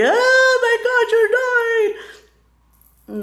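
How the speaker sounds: high, loud hammed-up lament; hysterical mock wail. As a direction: wail it like a melodramatic mourner. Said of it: "I don't wanna be".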